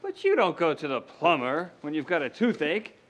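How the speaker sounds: normal talking voice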